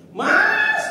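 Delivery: sudden high, piercing yell to a husband; full-voiced, high-pitched cry; shouted call. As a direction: scream it out in alarm, very high and loud. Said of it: "Dia teriak lagi sama suaminya".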